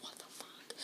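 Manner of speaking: nearly silent swear under the breath; whispered disbelief. Retold or imitated. Woman reacting to a guardsman's advances, whispered disbelief. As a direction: mouth it with hardly any sound.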